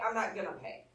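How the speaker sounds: ordinary talk, nothing marked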